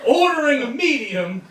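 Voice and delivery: ordinary delivery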